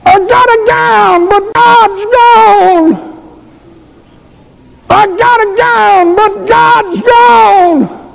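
high, slow, wailing chant; keen it like a lost soul in the road; crying lament. Retold or imitated. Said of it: "And she's crying".

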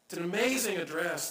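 ordinary delivery

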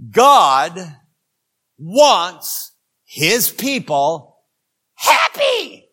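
the shocking statement, delivered with a leap; dramatic reveal. Shown as a demonstration. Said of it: "a statement that's going to shock you".

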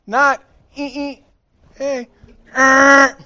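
high, loud beeping noises, playful; mock friendly honk. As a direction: toot it like a cheerful little horn. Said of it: "the person behind me goes".

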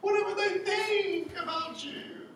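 high-pitched, drawn-out screech as the flesh; mock scream. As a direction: scream it slowly in a put-on, very high voice. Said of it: "the flesh is screaming at you".

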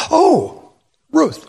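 shocked gasp. Retold or imitated. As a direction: voice jumps up in shock. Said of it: "it just shocked her".

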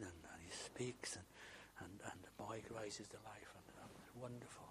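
soft whisper that fades almost to nothing; a hushed, fading whisper of awe; awed whisper. A narrator's reading. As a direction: let the wonder hang in the air. Raising of the widow's son.

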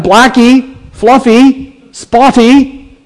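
high-pitched calls, name by name; shepherd calling out. Acted. Shepherd calling his sheep to him by name, calling out. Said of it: "He calls out to them".

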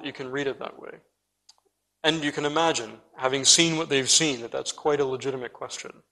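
ordinary talk

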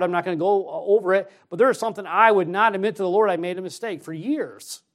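high, loud pulpit exhortation; forceful preaching. Real, not acted. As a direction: drive it home to the congregation.

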